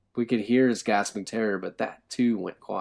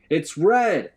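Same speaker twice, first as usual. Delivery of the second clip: frightened; 9 semitones higher, 9 dB louder than usual. A narrator's reading. Scared prisoner answers below.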